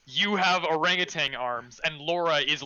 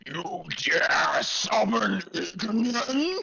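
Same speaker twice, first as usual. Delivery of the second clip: slow booming fire god; deep rumbling voice; 2 semitones higher, slower than usual. Acted. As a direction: thunder it out like an angry deity. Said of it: "a deep rumbling voice".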